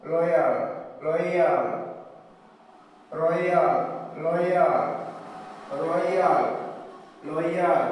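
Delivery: loyal and royal with a stretched ɔɪə glide; example words, emphatic. Shown as a demonstration. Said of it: "As in the words". (উদাহরণ শব্দ জোর দিয়ে)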